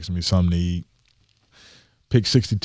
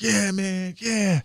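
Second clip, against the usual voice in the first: much higher, thrilled cheer of the agent; joyful agent; 13 semitones higher, much slower than usual. Retold or imitated. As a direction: agent jumping for joy, cheering way up high. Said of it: "my agent is jumping for joy".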